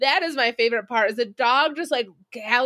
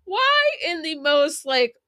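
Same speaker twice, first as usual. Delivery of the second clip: incredulous outburst; 6 semitones higher than usual. Retold or imitated. Viewer yelling at the pilot's choice, high and incredulous. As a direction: shout it at the screen in disbelief. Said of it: "And I'm like".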